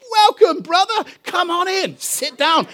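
high-pitched eager greeting; effusive welcome. Retold or imitated. Group member welcoming the Australian newcomer, effusive and high. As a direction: usher them in with a high, eager voice.

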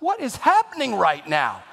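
as if you're being spied on; bewildered. Retold or imitated. Son caught coming home from the movies, mock bewildered cry. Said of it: "I'm like".

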